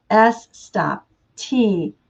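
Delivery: usual speaking voice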